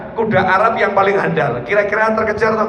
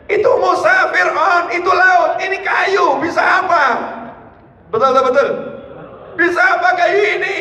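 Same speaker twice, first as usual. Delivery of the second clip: incredulous shout; 8 semitones higher than usual. Acted. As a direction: cry out that a stick can do nothing. Bani Israel disbelieving the stick. (umat Bani Israel berteriak tak percaya)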